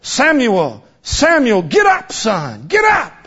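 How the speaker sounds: building call up to a high shout; rising urgent call; calling Samuel. Acted. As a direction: wake the boy from afar. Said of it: "a still small voice call him three times".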